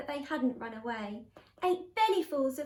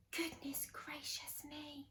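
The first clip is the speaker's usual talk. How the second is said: gasp, then slow whisper; shocked exclamation; 16 dB quieter than usual. A narrator's reading. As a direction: whisper it, aghast. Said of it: "looked into the water and exclaimed".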